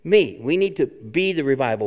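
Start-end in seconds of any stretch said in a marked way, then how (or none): none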